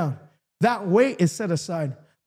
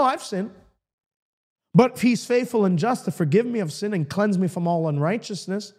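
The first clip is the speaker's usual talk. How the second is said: ordinary talk, nothing marked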